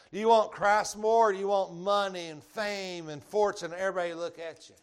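loud, emphatic preacher; preaching at full force. Acted. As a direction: preach it out, punching each question. Preaching climax on love of world.

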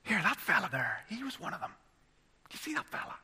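lightly acted Northern Irish servant girl; gossiping Northern Irish girl. Servant girl pointing out Peter to others, hushed Northern Irish gossip. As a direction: pitch climbing and volume dropping to a hushed Northern Irish aside. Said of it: "it's very Northern Irish, actually, the way she gets on".

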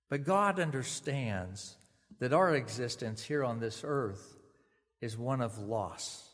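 normal talking voice

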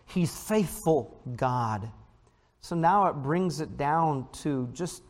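ordinary talk, nothing marked

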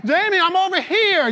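high urgent call across the room; Jesus calling out, high. Acted. Jesus calls out to the distracted Jamie, high and loud. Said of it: "Got Jesus chasing you around".

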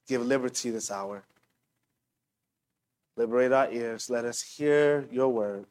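soft, unhurried prayer voice; opening prayer. Real, not acted. Pastor praying before the sermon, soft and reverent. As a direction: pray quietly and earnestly over the congregation.

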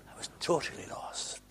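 breathy whisper, very soft; whispered confession. Real, not acted. Preacher admitting he was lost, whispered.

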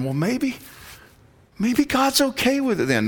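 high-pitched tentative musing; mock wishful doubter. Preacher mimics swayed people thinking maybe, in a high wishful voice. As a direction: pitch it way up, as if naively reconsidering.

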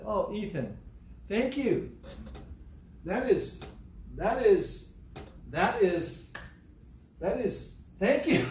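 grandma thanking Ethan for his drawing, high and gushing; gushing thanks. Retold or imitated. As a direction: melt with delight over the gift. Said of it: "She says".

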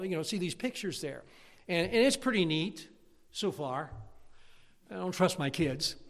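usual speaking voice

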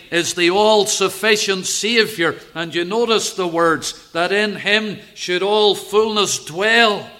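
loud, high, emphatic preaching; preaching climax. Acted. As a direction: drive it home to the congregation. Preaching at full force.

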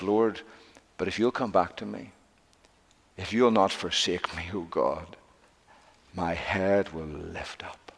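softly voiced plea to God; soft imagined prayer. Preacher voicing a broken man's prayer, soft. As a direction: pray it low and soft, slowing at the end.

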